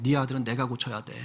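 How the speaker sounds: usual speaking voice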